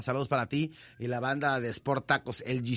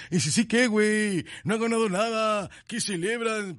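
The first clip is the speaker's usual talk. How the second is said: high, sneering mock whine; mocking the bitter fans; 7 semitones higher than usual. Host mimicking fans who scorn the celebrations. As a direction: whine it like a sour critic, put on. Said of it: "aquellos que".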